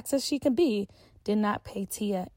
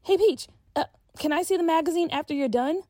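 lightly voiced young girl, a bit higher; a hint of a schoolgirl asking her friend; Tia, girl voice; 7 semitones higher, 4 dB louder than usual. A narrator's reading. Tia asks for magazine.

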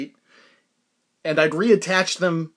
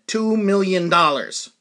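"Two million dollars" slow and louder, each word weighted; emphatic price demand; 3 semitones higher, 5 dB louder, slower than usual. Retold or imitated. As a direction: state "Two million dollars" slowly and firmly. Names absurd price to buyer.

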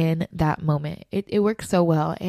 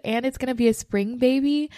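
ordinary delivery

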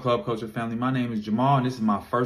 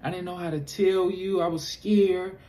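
imitating a sorry girlfriend, higher and hushed; apologetic girlfriend; 8 semitones higher, slower than usual. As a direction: play the remorseful girlfriend, slowing at the end.

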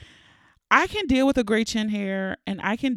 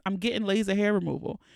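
usual speaking voice

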